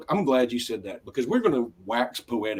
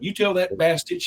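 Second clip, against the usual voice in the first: higher friendly Southern ribbing; imitating Houston guys; 7 semitones higher than usual. Quoting the Seinfeld Houston guys, mock Southern ribbing. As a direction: ham up the good-old-boy buddies. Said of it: "all they kept saying was".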